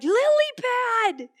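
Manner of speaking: high, loud, slow drawn-out holler; calling back. Retold or imitated. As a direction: shout a nickname across the street. Shouts back to Lily.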